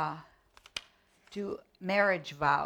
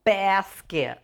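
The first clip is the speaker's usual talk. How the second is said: low, slow crooning wolf; soft lazy flirty drawl; 4 semitones lower, 7 dB louder, slower than usual. Pretend. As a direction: woo her like a smooth wolf. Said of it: "and he says".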